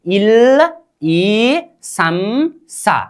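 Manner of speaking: very slowly, syllable by syllable, इल ई सम सा; slow number drill. Shown as a demonstration. Teacher drilling pupils on the number word, spelled out very slowly. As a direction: stretch out each syllable of इल ई सम सा. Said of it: "उच्चारण".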